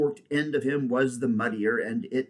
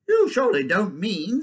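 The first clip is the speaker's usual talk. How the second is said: high, loud jackdaw voice; incredulous jackdaw; 8 semitones higher, 6 dB louder than usual. Acted. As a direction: challenge the badger as a jackdaw. Jackdaw questions badger in story.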